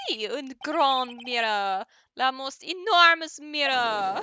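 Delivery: slow, loud French chef; grandly drawn-out French accent. Acted. Chef confirms the enormous mirror, French accent. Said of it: "I'm going to miss the accents".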